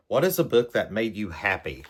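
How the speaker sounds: ordinary delivery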